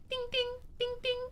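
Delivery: high, soft imitated bell dings; ding like a little bicycle bell; bike bell. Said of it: "like, a little bell".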